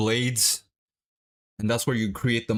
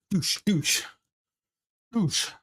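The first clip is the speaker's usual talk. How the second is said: rising, higher shadowboxing punch noises; punch sound effects; 10 semitones higher, much slower than usual. Shown as a demonstration. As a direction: mimic punches with quick mouth sounds. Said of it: "Or you can go like this".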